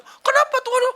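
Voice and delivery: put-on high, loud wailing little girl; crying child. Girl crying to her mother after losing, high and loud. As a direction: wail it like a heartbroken child. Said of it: "Kata anaknya".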